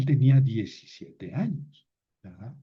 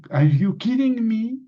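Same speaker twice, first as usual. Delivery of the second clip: Are you kidding me, pitched much higher and louder; English idiom; 9 semitones higher, 4 dB louder than usual. Shown as a demonstration. Teacher quoting an English idiom to students, high and loud. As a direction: toss out the English phrase high and bright. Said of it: "Kid es niño".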